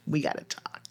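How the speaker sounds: hushed, whispered warning. Real, not acted. Coach warning those who wrote "I am", whispered. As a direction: drop suddenly into a whisper. Mock-serious warning to listeners.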